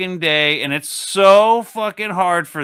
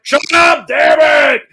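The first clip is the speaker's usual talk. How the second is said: loud, high angry shout; snapping dad; 9 semitones higher, 8 dB louder than usual. Retold or imitated. Dad driving yells at nagging kids, loud and fed up. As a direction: blow up at the kids from the driver's seat. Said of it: "And you're just like".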